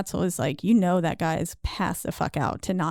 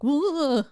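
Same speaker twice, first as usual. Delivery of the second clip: mock whoosh; 8 semitones higher than usual. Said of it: "just being like".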